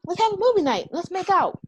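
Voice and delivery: airy, clueless parody; imitating Landon shrugging it off; mocking Landon. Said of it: "And then Landon was like".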